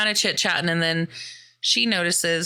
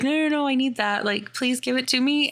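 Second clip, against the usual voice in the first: put-on pleading toddler; 7 semitones higher than usual. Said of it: "she's like".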